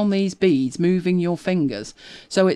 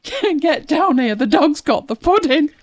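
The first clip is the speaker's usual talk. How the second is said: mum calling upstairs that the dog has the pudding, loud; retold scream up the stairs; 9 semitones higher, 4 dB louder than usual. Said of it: "she screams up the stairs".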